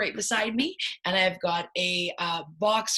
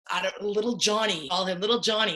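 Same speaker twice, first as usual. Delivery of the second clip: normal talking voice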